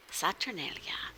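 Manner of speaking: breathy hushed whisper; soft dramatic whisper. Real, not acted. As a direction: whisper the name like a secret. Hushed reveal of next location.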